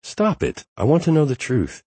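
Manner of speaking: high-pitched playful protest; excited. Acted. Harper playfully pushing him.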